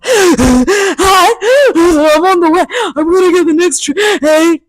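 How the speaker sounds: hammed-up breathless hello; breathless eager call. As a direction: gasp it out like you just caught the train. Out of breath phoning him.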